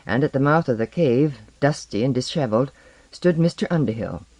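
ordinary delivery, nothing marked

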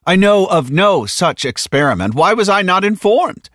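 high, loud, affronted outburst; indignant protest. Acted. Tatsumi learning of an unknown experiment, indignant. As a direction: demand an explanation, voice climbing.

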